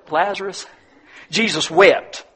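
ordinary talk, nothing marked

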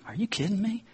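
normal talking voice

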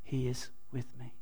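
soft, low murmur to yourself; quiet muttering. Shown as a demonstration. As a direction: pray it under your breath. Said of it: "you kind of mutter".

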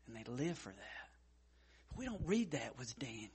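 whispered, hushed and close. Real, not acted. Preacher whispering to his church about Daniel, hushed. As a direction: lean in and whisper it to the congregation.

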